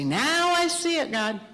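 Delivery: slow, high mock epiphany; mock realization. Elijah pretending to finally understand God, mock epiphany. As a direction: stretch it out like a sudden realization. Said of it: "He doesn't say".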